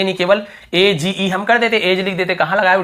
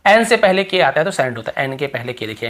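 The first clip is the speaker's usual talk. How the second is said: normal talking voice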